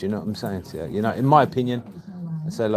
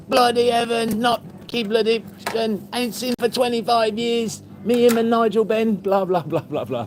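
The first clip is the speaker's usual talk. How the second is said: much higher, animated imitation; excited phone gabble; 13 semitones higher than usual. Shopkeeper on the phone to his old pal, excitedly imitated. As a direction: rattle on high-pitched, running into blahs.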